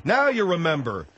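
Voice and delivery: feed the caller the answer, worked up; exasperated outburst. Acted. Host supplies answer, exasperated.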